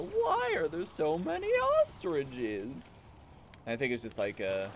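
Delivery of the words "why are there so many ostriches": high, drawn-out mock wail; slow high-pitched exasperation at normal volume; imitated comedy bit. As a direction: imitate an overwhelmed cartoon complaint. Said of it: "People going".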